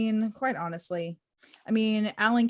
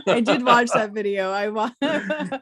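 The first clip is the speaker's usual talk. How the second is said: talking through real laughter, louder; laughing; 5 dB louder than usual. Real, not acted. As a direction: crack up while admitting it. Both laughing about the video.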